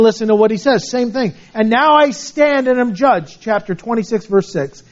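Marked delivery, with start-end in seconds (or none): 1.5-3.4 raised, measured scripture read. Acted. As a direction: read it out like holy writ. Reads scripture aloud.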